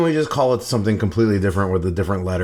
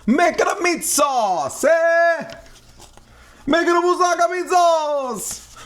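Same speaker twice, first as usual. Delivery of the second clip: hammed-up announcer call. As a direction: belt it out like a TV chef. Hams up announcing the dish.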